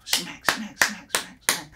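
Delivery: soft voiceless smack noises; imitated smacking blows. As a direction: mimic a flurry of slaps to the face. Imitating his punches.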